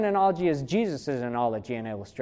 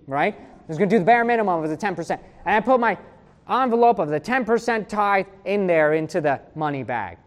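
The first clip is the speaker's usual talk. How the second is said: ordinary talk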